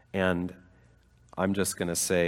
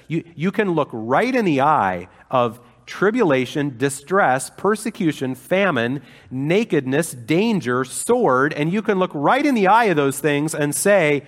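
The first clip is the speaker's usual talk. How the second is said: rising louder and higher through the list; preacher's rising build toward the climax; building fervour; 6 semitones higher, 6 dB louder than usual. Real, not acted. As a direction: stack each hardship with growing force.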